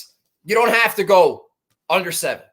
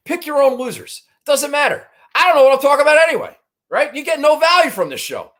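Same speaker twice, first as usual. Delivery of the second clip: sarcastic rant; 7 semitones higher, 3 dB louder than usual. Real, not acted.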